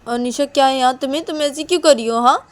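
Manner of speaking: high, loud acted telling-off; exasperated scolding. Confronting a friend over her behaviour, exasperated scolding.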